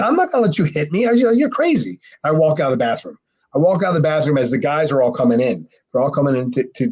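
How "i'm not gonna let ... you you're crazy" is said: high, retold outrage; pitched-up disbelief; incredulous refusal. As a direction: refuse as if it's absurd. Refuses Chad's absurd request.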